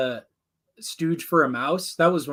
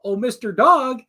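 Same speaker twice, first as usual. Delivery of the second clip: playful rooster impression, pitched up; Foghorn Leghorn; 6 semitones higher than usual. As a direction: egg someone on as a cartoon rooster. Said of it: "I just love Foghorn Leghorn".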